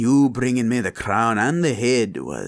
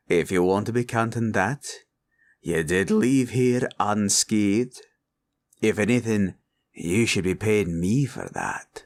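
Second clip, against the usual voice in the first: ordinary delivery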